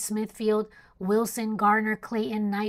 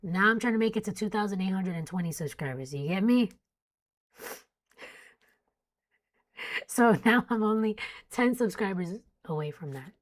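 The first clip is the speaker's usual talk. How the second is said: ordinary delivery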